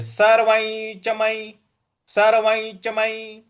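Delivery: सर्वं च मयि intoned, सर्वं gliding into "nycha"; slow level chant, ञ linking सर्वं to च; palatal nasal before च. Shown as a demonstration. Teacher modelling सर्वं च मयि, slow nasal link. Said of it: "इस प्रकार से बोलना है".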